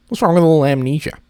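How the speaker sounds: high, fast, playful protest; a touch of hammed-up defensiveness; mock defensive. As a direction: fire it off quickly in mock indignation. Defensive joking.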